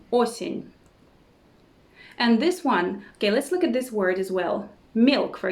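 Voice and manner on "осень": usual speaking voice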